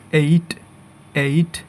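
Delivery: এইট with a gliding ei vowel; correct pronunciation. Shown as a demonstration. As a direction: say এইট as "eit", drawn through the glide. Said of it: "সঠিক উচ্চারণ বা phonetics".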